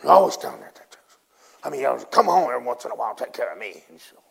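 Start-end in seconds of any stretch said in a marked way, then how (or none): 0.0-1.0 retold angry complaint, rising to a loud burst; mad husband. Husband complaining about his wife at church, angrily. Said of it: "her husband got mad and said". 1.6-3.8 rapid retold grumble, loud at first; mad husband. Husband telling his wife to come home, crossly. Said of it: "her husband got mad and said".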